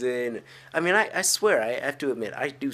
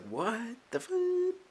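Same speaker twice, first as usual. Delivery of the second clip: stunned disbelief; 4 dB quieter, much slower than usual. Retold or imitated. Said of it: "I was like".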